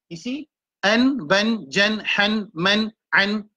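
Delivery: each syllable spelled out clearly; modelled sounds. Shown as a demonstration. Teacher sounding out Arabic letters for the class, clipped and clear. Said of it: "c'est le son".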